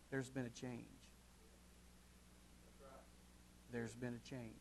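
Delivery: very soft, low repeated line; hushed emphasis. Acted. Preacher telling the congregation something changed, hushed. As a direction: drop to a near whisper. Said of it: "hushed repetition".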